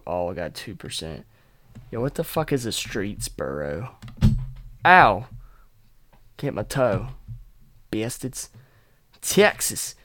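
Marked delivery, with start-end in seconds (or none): 4.8-5.2 sharp loud ow; yelp of pain. Real, not acted. Stubbing a toe mid-podcast, yelping. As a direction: yelp as you stub a toe.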